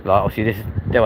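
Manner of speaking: ordinary delivery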